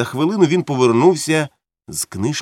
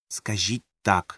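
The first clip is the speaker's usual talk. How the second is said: softer, slow, firm order; quiet command; 3 semitones lower, 7 dB quieter, slower than usual. A narrator's reading. Faber orders Montag through the earpiece, quiet and firm. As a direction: instruct him quietly but firmly. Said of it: "наказав Фабер".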